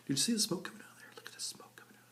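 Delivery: quick hushed whisper. Real, not acted. Showing viewers the smoke in a fast whisper. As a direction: point it out under his breath.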